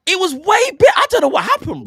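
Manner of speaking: loud, high, baffled outburst; exasperated protest. Real, not acted. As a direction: burst out in high-pitched disbelief. Protests his accent was better before.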